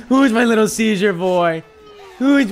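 put-on sing-song baby voice; baby talk. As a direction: coo at it like a puppy. Cooing resumes.

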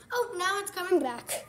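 ordinary delivery

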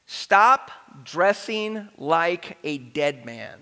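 half-speed, word by word with pauses; measured dictation of a main point; slow, deliberate delivery. Real, not acted. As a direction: spell out the key point slowly. Announces the big idea for notes.

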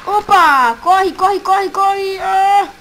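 panicked shouting while fleeing; being chased. Real, not acted. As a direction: yell in panic, running for your life.

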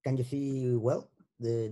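normal talking voice

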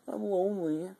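high, soft, pitiful little plea; mock lonely. Vlogger begging viewers to talk to him, mock lonely. Joke plea for comments, then retracted.

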